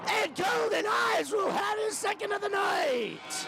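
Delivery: building to a high, drawn-out roar; shouted goal call. Real, not acted. Hockey announcer celebrating a power play goal, shouting. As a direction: explode on the score and stretch the words out high.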